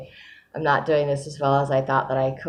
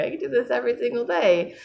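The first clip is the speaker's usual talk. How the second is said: bright mock eager exclaim, pitched high; hammed-up enthusiasm for daily sessions; 9 semitones higher than usual. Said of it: "where I'm like".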